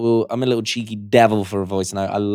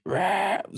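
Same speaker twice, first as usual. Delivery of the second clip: higher, quieter crusty growl; 9 semitones higher, 9 dB quieter than usual. Shown as a demonstration. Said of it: "that crusty".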